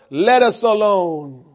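low, soft, slow growl of a demon; a hint of a cowering demon; lightly voiced demon pleading. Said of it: "The demon said".

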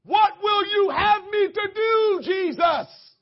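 loud, high, slow shouted plea; crying out to Jesus. Shown as a demonstration. Preacher showing the church how to answer God, a loud shouted plea. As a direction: cry it out to heaven. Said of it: "here are four different ways you can respond".

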